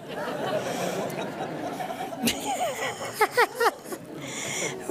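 long, high, breathy laugh. Real, not acted. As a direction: laugh at yourself. Laughs at her own story.

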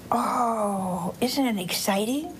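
ordinary talk

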